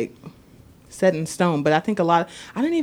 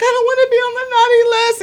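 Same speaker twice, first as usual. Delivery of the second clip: put-on much higher tearful kid voice; imitating whiny child; 13 semitones higher, faster than usual. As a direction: whimper it like a little boy. Imitating crying son.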